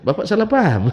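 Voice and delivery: pleading retort. Retold or imitated. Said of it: "retelling confrontation, laughing".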